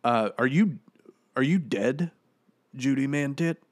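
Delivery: high, loud, sarcastic rising question; mocking question. Dead actor taunts the commenter Judy, sarcastic.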